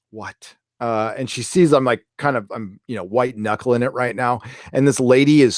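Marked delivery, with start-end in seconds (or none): 0.0-0.6 very soft, sleepy sigh and question; low, hushed mumble after a sigh; groggy waking up. Retold or imitated. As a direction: imitate someone just waking, barely audible. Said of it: "like, waking up going".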